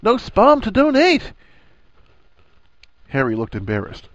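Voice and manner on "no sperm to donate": loud, high, gleeful exclamation; raucous, high-pitched hilarity; laughing. A narrator's reading. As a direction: shout it out through your amusement. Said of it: "Ron laughed".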